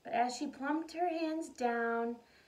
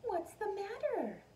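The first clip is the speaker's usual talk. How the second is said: gentle mother; 10 semitones higher than usual. A narrator's reading. Mother asks upset daughter.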